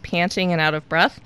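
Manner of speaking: ordinary talk, nothing marked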